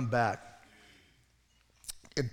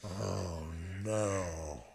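soft, lower "oh no"; dismayed; 7 semitones lower, 7 dB quieter than usual. Pretend. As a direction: let it drop in quiet dismay. Peter's pride crashes.

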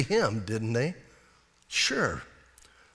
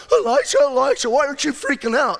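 panicky, higher and louder calls; freaked out; 9 semitones higher, 6 dB louder, faster than usual. Retold or imitated. Servant calling his master on the wall, in hammed-up panic. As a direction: call out to Elisha in alarm. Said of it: "he's all freaked out".

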